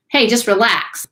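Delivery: casual urging. Pretend. Teacher mimics someone in the room telling you to relax, brisk urging.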